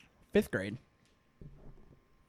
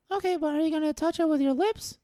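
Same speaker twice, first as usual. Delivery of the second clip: put-on little boy voice; 7 semitones higher than usual. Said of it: "little Joey".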